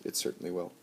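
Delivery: usual speaking voice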